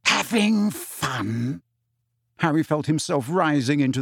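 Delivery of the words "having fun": very slow, higher, menacing question; suppressed rage. A narrator's reading. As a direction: draw it out with icy fury.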